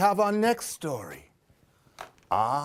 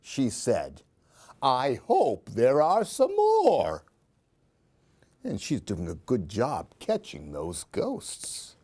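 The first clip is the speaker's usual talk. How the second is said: put-on lively read-aloud for a child; expressive children's story reading; storybook narration; 4 semitones lower than usual. A narrator's reading. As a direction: tell it like a bedtime picture book. Reads picture book aloud.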